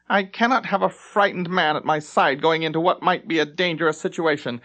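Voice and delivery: strip him of duty with scorn; contemptuous captain. Acted. Captain ordering the lieutenant to his quarters, high and scornful.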